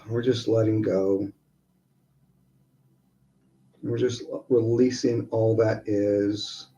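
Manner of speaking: soft, unhurried breathing guidance; guided meditation. Acted. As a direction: ease them into each breath.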